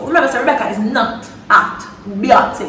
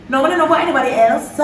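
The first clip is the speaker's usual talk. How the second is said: ordinary delivery, nothing marked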